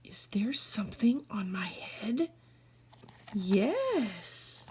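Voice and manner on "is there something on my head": hushed, fast, breathy question; worried whisper. Acted. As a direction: whisper it nervously. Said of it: "whispered worried question".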